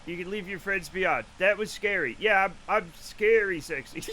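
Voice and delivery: ordinary delivery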